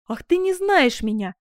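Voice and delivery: loud, high, impassioned cry; fervent exclamation; exclaiming. Acted. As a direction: burst out in proud protest. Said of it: "воскликнула герцогиня".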